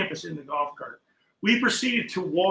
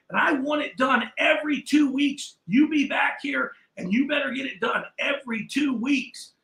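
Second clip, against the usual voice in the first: higher, bossy shop owner insisting; demanding customer; 8 semitones higher than usual. Retold or imitated. Shop owner orders the kid back every two weeks, higher and bossy. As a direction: lay down the law like a fussy client.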